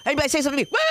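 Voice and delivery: ordinary delivery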